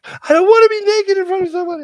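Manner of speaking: very high, loud mock shriek; hammed-up high-pitched outburst; shrieking protest. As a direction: squeal it out as a mortified daughter. Embarrassed daughter protests in story.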